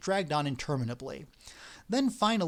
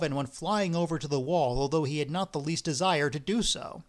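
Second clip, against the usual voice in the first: ordinary delivery, nothing marked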